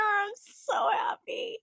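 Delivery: very high, slow, teary happy voice; happy crying. Real, not acted. Fan overwhelmed by Luffy's arrival, crying with joy. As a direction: say it through tears of joy.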